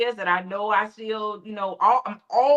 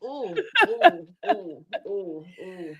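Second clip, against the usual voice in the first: laughing ooh; slower than usual. Real, not acted. Co-host reacting to comparison killing joy, laughing. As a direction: laugh out each ooh, hit by the truth.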